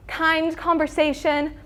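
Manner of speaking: ordinary delivery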